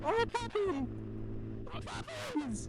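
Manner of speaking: very high, delighted squeals; excited squeal. Real, not acted. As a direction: squeal with joy at the puppies. Sees puppies, squeals.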